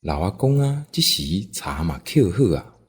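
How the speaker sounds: ordinary delivery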